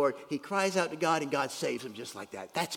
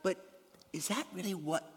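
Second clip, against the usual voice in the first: usual speaking voice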